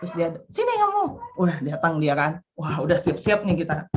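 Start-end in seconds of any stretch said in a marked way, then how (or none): 0.5-1.0 high, commanding "sini kamu"; mother ordering child over; sharp summons. Retold or imitated. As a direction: call the child over sternly.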